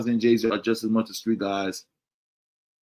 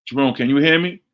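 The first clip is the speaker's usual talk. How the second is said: louder, higher, checking the connection; calling to check mic; 4 semitones higher, 7 dB louder than usual. Real, not acted. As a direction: call out to see who can hear you. Checking if listeners hear him.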